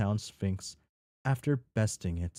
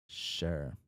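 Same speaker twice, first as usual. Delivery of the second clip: resigned, a touch quieter and lower; a hint of empty despair; hopeless resignation; 4 semitones lower, 6 dB quieter than usual. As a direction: say it like a widow with nothing left to lose. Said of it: "in the manner of a window who has nothing left to lose".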